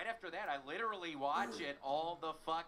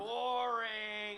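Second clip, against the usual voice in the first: heckling call; 4 semitones higher, 4 dB louder than usual. Acted. Heckle catchphrase.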